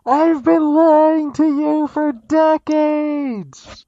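very slow, loud and high; to someone hard of hearing. Pretend. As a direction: stretch each word out loudly. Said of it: "Could you speak up, please?".

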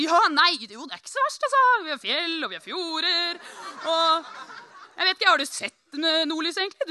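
suddenly switched on, boasting to a stranger; eager showing off. Retold or imitated. Retold eager boasting about Norway. (ivrig, høylytt skryt)

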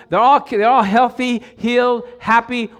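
ringing praise; full-force preaching. Real, not acted. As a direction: build the crowd up to joy.

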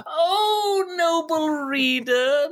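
high, slow theatrical wail; mock wail. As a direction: wail it like a tortured soul. Wailing address in dramatic reading.

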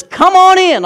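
put-on boy innkeeper cheerfully welcomes Joseph, high and loud; eager welcome. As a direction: fling the door open with over-the-top cheer. Innkeeper boy's prank welcome.

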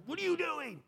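high, sharp arguing; angry retort. Retold or imitated. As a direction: snap back at the leader in a quarrel. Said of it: "This is like loud arguing".

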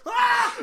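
high, loud screaming Mario; hammed-up terrified shriek; mock shriek. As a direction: shriek like falling through a painting. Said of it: "it's this horrible".

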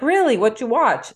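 high, loud surprised "Really?". Retold or imitated. Answering her daughter's text, surprised. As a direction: act surprised at the news.

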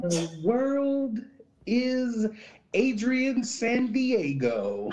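high, slow announcer boom. Pretend. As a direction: announce it like a show host. Mock announcement of guest.

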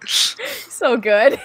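laughing. Real, not acted. Laughing after joke.

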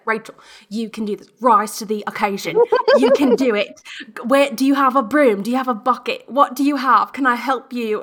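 imitated Australian friend, urgent and rallying; Australian accent. Friend on FaceTime rallies her to face the squirrel, Australian accent. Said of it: "really beautiful Australian accent".

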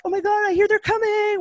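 high, breathless excitement of roommates; giddy squeal. Retold or imitated. Roommates in towels waiting for runners, squealing high. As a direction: mimic excited girls waiting. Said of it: "and like".

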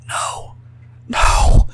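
anguished whispered denial. A narrator's reading. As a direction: breathe it out in despair. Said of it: "she whispered".

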